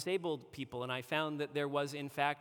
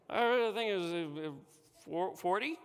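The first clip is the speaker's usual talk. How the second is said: sheepish hesitation; 4 semitones higher, 3 dB louder than usual. Retold or imitated. Injured driver hesitantly answering the police officer, sheepish. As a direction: stall nervously before naming a number.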